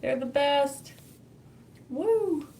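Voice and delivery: ordinary talk, nothing marked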